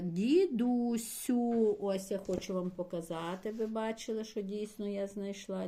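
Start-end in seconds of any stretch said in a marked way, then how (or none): 0.0-1.8 "дідусю" drawn out very slowly; teacher dictating slowly while searching the text; slow dictation. Shown as a demonstration. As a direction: stretch "дідусю" for the class. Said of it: "шукаю".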